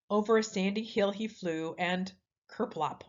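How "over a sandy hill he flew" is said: ordinary delivery, nothing marked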